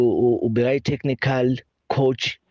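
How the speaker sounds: ordinary delivery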